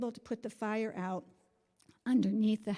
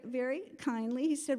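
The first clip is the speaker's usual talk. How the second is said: ordinary talk, nothing marked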